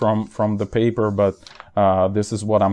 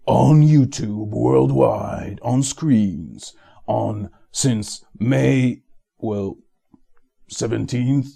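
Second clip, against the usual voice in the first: trailer announcer voice; slower than usual. Acted. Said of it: "So this was the trailer for this video".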